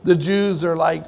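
normal talking voice

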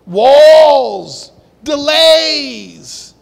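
loud, high climax of the list; loud preacher's climax; preaching build. Real, not acted. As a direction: hit them hard.

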